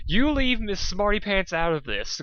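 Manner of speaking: sharp, high, loud snap back; angry snap at Spike; glaring retort. Acted. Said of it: "glared Dusk".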